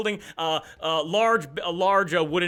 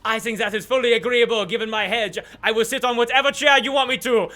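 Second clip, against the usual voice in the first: higher, louder, eager to comply; anxiously pleading Duke; 5 semitones higher, 5 dB louder than usual. Acted. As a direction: bargain for your life in a put-on voice. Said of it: "negotiate for his life right now".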